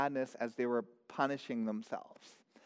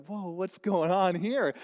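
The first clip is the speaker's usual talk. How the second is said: put-on bewildered kid; 4 semitones higher, 6 dB louder than usual. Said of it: "looking around being like".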